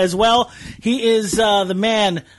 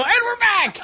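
high-pitched show-return yell; announcer-style comeback shout; 13 semitones higher than usual. Shown as a demonstration. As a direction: holler it like coming back from a break. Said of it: "I'm gonna go".

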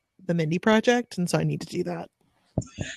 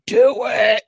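louder, higher put-on kid command; bossy toddler demand; 4 semitones higher, 6 dB louder than usual. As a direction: demand it like an insistent three-year-old. Toddler insisting, voiced by mother.

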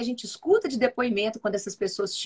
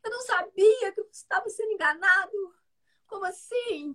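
high, startled voice of a duped client; shocked newcomer; 12 semitones higher than usual. Retold or imitated. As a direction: squeal it like someone who just learned they were fooled. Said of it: "Falou assim". (voz alta e assustada de quem descobriu que foi enganado)

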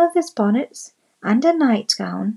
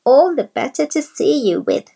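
wolf voice; 6 semitones higher, 6 dB louder than usual. A narrator's reading. Wolf in disguise answers.

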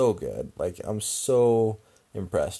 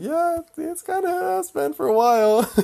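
very high, loud squeaky voice; comically high-strung answer; strained mock reply; 8 dB louder than usual. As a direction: squeal it out, nearly laughing. His strained reply to startled mom.

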